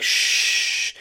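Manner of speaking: a soft unvoiced shh; quiet shush to silence him. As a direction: hush him with a breath. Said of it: "she's like".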